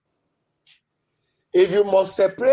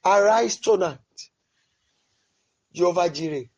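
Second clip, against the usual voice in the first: usual speaking voice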